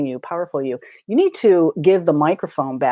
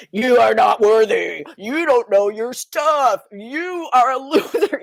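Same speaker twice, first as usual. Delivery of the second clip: higher, slow, taunting put-down; inner critic sneering; 6 semitones higher, slower than usual. Acted. Puny you at the microphone, taunting the listener slowly. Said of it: "it's screaming at you".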